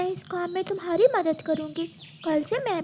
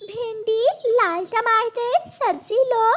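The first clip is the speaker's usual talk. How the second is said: drawn-out hawker's cry, a bit higher and louder; vegetable seller calling out; 4 semitones higher, 3 dB louder, slower than usual. Acted. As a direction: call out your vegetables slowly down the street. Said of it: "आवाज लगाती हुई चलती है". (सब्ज़ी वाली की तरह धीमे खींचकर आवाज़ लगाना)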